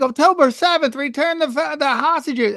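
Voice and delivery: high mock chant of protesters; imitating protesters. Protesters chanting at Israel, high mock chant. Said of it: "everyone's like".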